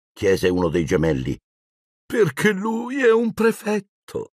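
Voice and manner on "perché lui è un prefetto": high, slow, cooing pride; gushing maternal fondness; tender, doting mother. A narrator's reading. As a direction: stretch it out with melting affection. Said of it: "disse la madre tutta intenerita".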